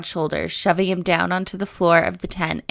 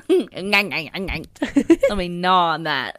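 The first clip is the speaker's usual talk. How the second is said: very slow, drawn-out tease; mock seductive; much slower than usual. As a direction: drag it out like a joke come-on. Joking seductive riff.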